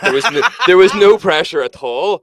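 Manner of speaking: high, loud, laughing objection; laughing protest. Real, not acted. Denying album pressure to bandmate, laughing protest. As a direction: protest loudly through laughter.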